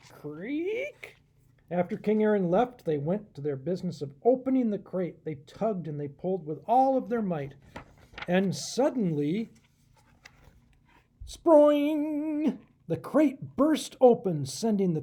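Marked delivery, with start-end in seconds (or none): 0.1-1.2 high creaky door sound; creaking sound. Acted. Reading the story, creaking crate noise. 11.3-12.6 loud high springy boing; sproing sound. Acted. Crate bursting open in the story, loud sproing.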